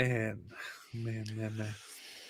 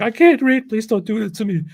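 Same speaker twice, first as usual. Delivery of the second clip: high, loud mock plea; hammed-up desperate begging; panicked pleading; 10 semitones higher, 8 dB louder than usual. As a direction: beg in a raised, frantic voice. Acted frightened plea in retold story.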